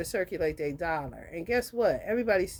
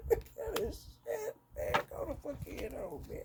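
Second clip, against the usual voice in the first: hushed aside; 13 semitones higher, 9 dB quieter than usual. Real, not acted. To someone nearby, waving them off, hushed. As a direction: mutter it under your breath.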